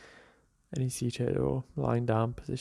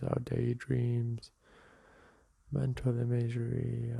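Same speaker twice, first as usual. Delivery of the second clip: calm, softer meditation guide voice; guided meditation; 3 dB quieter, slower than usual. Acted. Teacher guiding listeners through a seated meditation, calm and soft. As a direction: ease the listener into the breath.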